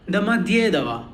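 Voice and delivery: "Damadyedava" with a long stressed "dye", light vowels around it. Shown as a demonstration. Teacher pointing out her stressing, long "dye" in "Damadyedava". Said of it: "stressing more on dye".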